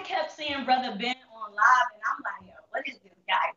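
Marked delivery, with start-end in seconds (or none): 2.5-3.5 whisper. Retold or imitated. Inner thought on seeing livestream.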